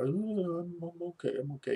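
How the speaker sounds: high, strained "I'm okay" while hurting; fake brave. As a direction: pretend to be fine through the pain. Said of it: "I'm lying".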